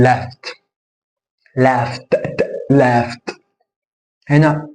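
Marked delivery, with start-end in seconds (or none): 0.0-3.4 "لافت" as "laft" with the T released clearly; T ending. Shown as a demonstration. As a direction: isolate the T, then say "لافت". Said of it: "اقول لافت".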